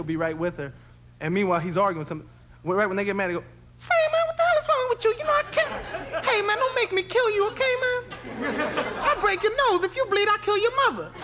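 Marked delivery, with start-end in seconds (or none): 3.8-11.1 very high, squealing mock rage; high angry voice. A guy furious at another man, in a very high angry voice. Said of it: "they talk real high".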